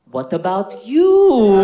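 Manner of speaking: slow, loud, drawn-out fatherly question; imitating dad. Greek dad at the pool fishing for a coming out, slow loud question. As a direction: stretch it out hopefully like a dad fishing. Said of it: "He goes".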